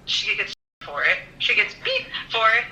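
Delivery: ordinary talk, nothing marked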